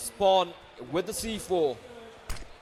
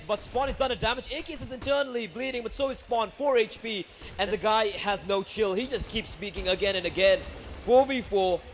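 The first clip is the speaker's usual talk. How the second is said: usual speaking voice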